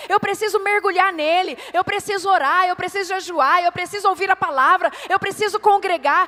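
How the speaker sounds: loud, urgent exhortation; fervent preaching. Real, not acted. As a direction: drive each phrase home at full force. Preaches at full force.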